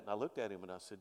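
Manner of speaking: usual speaking voice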